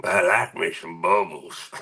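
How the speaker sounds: low-pitched character voice; Sling Blade. Acted. Repeating the bubbles joke for chat, Sling Blade voice. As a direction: put on a deep film-character impression. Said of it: "In my best sling blade, sling, sling blade voice".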